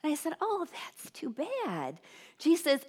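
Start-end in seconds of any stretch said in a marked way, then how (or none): 0.4-2.0 soft, slow, high-pitched sympathy; imitating a gentle commiseration; sympathetic. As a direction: coo it gently, drawn out. Acted sympathy for cashier's headache.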